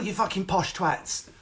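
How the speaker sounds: usual speaking voice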